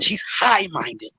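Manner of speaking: usual speaking voice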